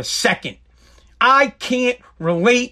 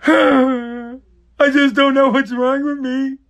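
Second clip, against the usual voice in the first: mock crying; 3 semitones higher, much slower than usual. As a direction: ham up a rich man's self-pity. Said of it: "sitting in your mansion being like".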